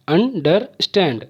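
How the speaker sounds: "Understand" in three beats, अन-डर-स्टैंड; small pauses between the parts of "understand"; syllable by syllable. Shown as a demonstration. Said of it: "तीन बार इसका उच्चारण हुआ है". ("Understand" को तीन टुकड़ों में बोलकर)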